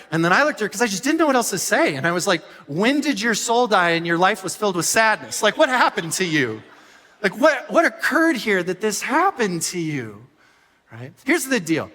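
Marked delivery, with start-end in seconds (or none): 2.7-10.3 exaggerated disbelief, louder and higher; hammed-up dismay at her taste; mock despair. A husband mock-despairing at his wife's tortilla opinion, louder and higher. Exasperated reply to wife.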